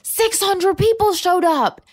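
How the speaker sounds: high, loud, drawn-out squeal; stretched-out, high-pitched mock excitement; super-excited imitation. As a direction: announce it like a thrilled event host. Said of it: "she's super excited".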